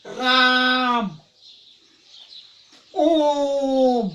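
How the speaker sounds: राम stretched out very slowly, vowel held long; prolonged pluta vowel, sung out; long drawn vowel. Shown as a demonstration. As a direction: drag out the vowel in राम and ओम like a chant. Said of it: "उच्चारण ज्यादा लंबा लेना पड़ता था".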